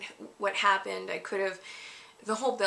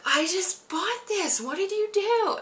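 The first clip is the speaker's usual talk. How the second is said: high, strained mom voice, much higher pitch; imitated upset mom; 12 semitones higher, 4 dB louder than usual. Mom confronting her kid over the burnt bedspread, high and upset. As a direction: play the mom, shocked and exasperated.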